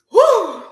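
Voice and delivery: high, loud exhale of disgust; disgusted whew. Real, not acted. Reacting to the dirty-men trend with a loud disgusted whew.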